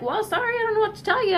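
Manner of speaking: high mock shrug of an indifferent doctor; mocking doctors who dismiss a suffering patient, high and offhand; dismissive doctor. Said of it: "And they're like".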